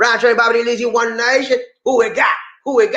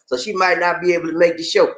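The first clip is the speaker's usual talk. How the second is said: normal talking voice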